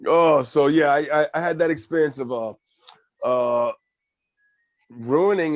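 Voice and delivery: ordinary delivery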